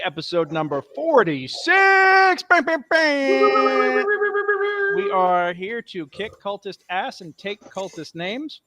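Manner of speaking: hammed-up announcer building to drawn-out, high, loud bangs; game master's show intro. Dungeon Master welcoming everyone to the episode, theatrical announcer intro. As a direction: open the show like a ring announcer, stretching key words.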